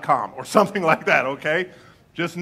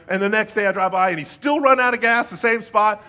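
higher, louder, building emphasis; animated storytelling; 5 semitones higher, 7 dB louder than usual. Real, not acted. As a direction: tell it with rising energy. Excited retelling, animated.

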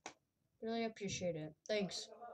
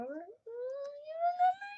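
squeaky plea. Acted.

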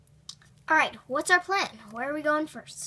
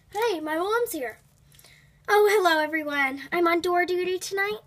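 normal talking voice

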